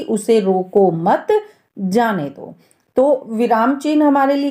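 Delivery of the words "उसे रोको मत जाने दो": slow "उसे रोको मत", break, "जाने दो"; pause after मत. Shown as a demonstration. Teacher explaining the clarified meaning, pause after मत. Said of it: "हमें स्पष्ट समझ आ रहा है".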